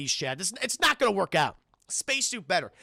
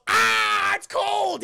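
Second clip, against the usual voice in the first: high, loud alien cry; pained alien yelp; 11 semitones higher, 6 dB louder, much slower than usual. Retold or imitated. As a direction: yelp from the sudden cold. Alien hit by cold air.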